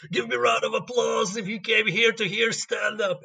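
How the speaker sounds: high, loud, put-on demanding shout; hammed-up aggressive comic; yelling comedian. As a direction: bark orders at the audience. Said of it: "Just yelling".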